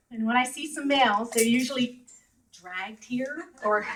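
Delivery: ordinary talk, nothing marked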